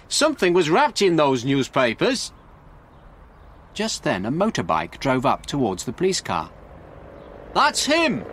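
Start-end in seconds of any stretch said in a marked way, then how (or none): 0.1-2.3 raised, higher urgent voice; excited shout. A narrator's reading. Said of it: "shouted Frank". 7.5-8.2 high cry of recognition; excited shout. A narrator's reading. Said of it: "shouted Frank excitedly".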